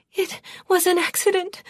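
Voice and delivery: very high, frantic excuse; panicky, desperate protest; feverish. A narrator's reading. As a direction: plead feverishly in a thin high voice. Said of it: "Palila said feverishly".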